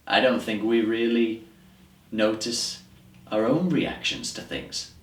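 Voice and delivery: exasperated, emphatic speech with heavy pauses; troubled monologue character. Acted. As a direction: press the point in dismay, dwelling on key words. Continues the audition monologue.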